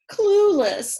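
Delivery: high, loud scoffing hen; scornful hen. A narrator's reading. Hen agreeing with the first about the pigs, high scoffing. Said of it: "agreed the other".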